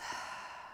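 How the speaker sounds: long breathy sigh out through the mouth; audible exhale. Shown as a demonstration. Yoga teacher shows students the out-breath, audible sighing exhale. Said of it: "out through the mouth".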